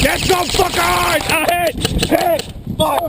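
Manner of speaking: excited shout. Acted. Firefight callouts.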